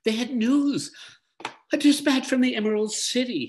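put-on high, eager teenage boy. A narrator's reading. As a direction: voice young Lear, breathless and high. Boy Lear's high quoted voice.

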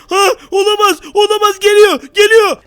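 frightened shouting. Acted. Villain appears again. (korkuyla bağırma)

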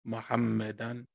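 careful slow teaching recitation; slow Arabic. Shown as a demonstration. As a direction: draw it out softly for a learner to repeat. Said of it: "I'm gonna say it slow in Arabic".

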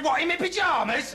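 high-pitched, affronted question; incredulous. Acted. First reply to boss indoors.

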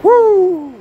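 drawn-out, high "woo!"; MJ75's woo. Retold or imitated. Vlogger demonstrates another creator's signature call, long high whoop. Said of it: "I have to do his".

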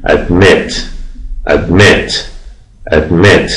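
light first syllable in admit, stressed MIT; stress on second syllable. Shown as a demonstration. Teacher asking the class to notice stress, admit stressed on MIT. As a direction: admit leaning firmly on MIT. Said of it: "هجای دوم فشار گرفته".

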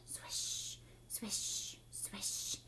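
soft, drawn-out whispered swish; slow whispered swishes. A narrator's reading. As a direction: breathe each swish out softly. Boat circles slowly.

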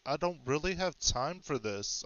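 usual speaking voice

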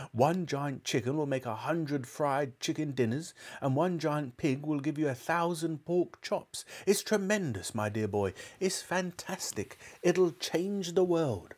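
high, marvelling enthusiasm; worked-up excitement. A narrator's reading. Father waving his arms over the invention, high excited wonder. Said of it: "waving his arms".

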